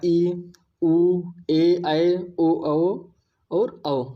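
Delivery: each long vowel ई ऊ ए ऐ ओ औ drawn out slowly and clearly; long vowels spoken slowly and distinctly for students; long vowels demo. Shown as a demonstration. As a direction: hold every vowel for two beats, slowly. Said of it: "आप आ बोलेंगे दो मात्रा का समय लग रहा है".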